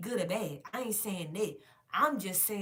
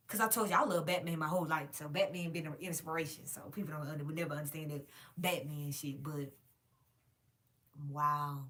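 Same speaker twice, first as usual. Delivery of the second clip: ordinary talk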